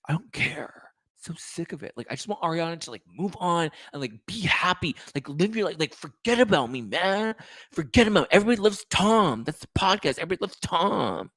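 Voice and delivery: high, breathy, whiny Sandoval voice; Sandoval impression. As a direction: do your breathy Sandoval, sulky and self-pitying. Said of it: "my Sandoval impression".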